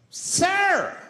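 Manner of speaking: high, loud cry of protest; indignant shriek, played for laughs; mock outraged passenger. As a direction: shout it in shocked objection. Outraged passenger confronts smoker.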